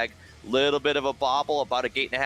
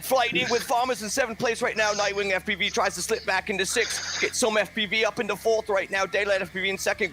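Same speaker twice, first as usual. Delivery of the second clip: mock British accent, higher sing-song race call; 4 semitones higher than usual. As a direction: ham up the British caller, crumpets and all. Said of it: "in a British accent".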